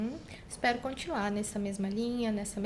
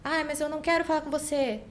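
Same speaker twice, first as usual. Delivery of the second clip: put-on slightly whiny child quote, higher; lightly voiced child; 6 semitones higher, 4 dB louder than usual. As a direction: lightly imitate a child's refusal, pitched up. Terapeuta imita criança que repete frases. (leve imitação de criança, mais aguda)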